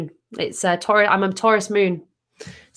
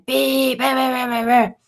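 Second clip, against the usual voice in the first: mock horn beeps, a bit higher; mock honking at an old lady; imitated car horn; 4 semitones higher than usual. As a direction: toot it out like an angry car horn. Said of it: "And he's going".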